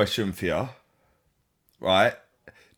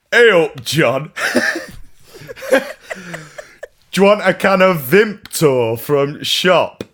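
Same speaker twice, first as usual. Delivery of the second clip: a light Yorkshire flatmate, louder; Yorkshire accent; 7 semitones higher, 5 dB louder than usual. Retold or imitated. Friendly mock Yorkshire flatmate, a touch louder. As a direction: flatmate from York offers a can of Vimto, in a light Yorkshire accent. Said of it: "I was gonna do an accent there".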